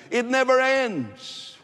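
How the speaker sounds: high, drawn-out exasperation; exasperated climax. Real, not acted. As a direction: stretch it out high, as if it drags on forever. Exasperated climax about endless religion.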